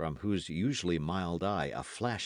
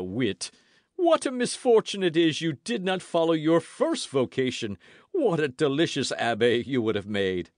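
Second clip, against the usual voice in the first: high, hearty, amused musketeer; delighted Porthos; 10 semitones higher, 9 dB louder than usual. A narrator's reading. Porthos praises Aramis's wit, delighted. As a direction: exclaim with a laugh in a raised voice.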